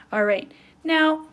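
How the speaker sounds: ordinary talk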